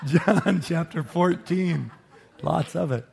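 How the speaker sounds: real chuckle breaking into the words; trying to carry on while laughing. Real, not acted. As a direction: announce it through laughter. Laughing after his failed accent joke.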